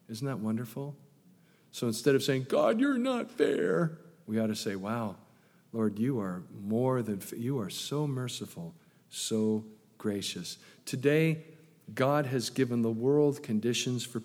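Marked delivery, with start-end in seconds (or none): 2.5-4.0 mock accusing whine. Voicing complaint to God. 4.8-10.6 softer, lower thanks; quiet awed praise. Retold or imitated. Believer praising God instead of complaining, soft. Voicing grateful prayer.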